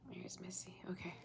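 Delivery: soft unvoiced whisper; whispered aside. Real, not acted. Reader fussing over her messy hair, hushed whisper. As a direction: say it under your breath to yourself.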